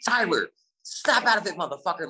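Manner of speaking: high, urgent retold shout; shouted demand. Surfer shouting at his shell-shocked friend in the waves, shouted demand. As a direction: shout him out of his daze. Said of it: "shouting demands at him".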